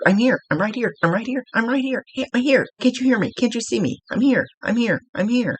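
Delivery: high, insistent, repeating it eagerly; a spirit begging to be noticed; excited spirit calling. Retold or imitated. Spirit calls out to her friend, high and eager. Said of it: "Very excited, trying to get your attention".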